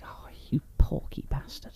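unvoiced mock insult; whisper. Real, not acted. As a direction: hiss it teasingly under breath.

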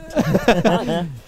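high, loud laugh; cracking up. Real, not acted. Co-host still cracking up at the song, a loud laugh.

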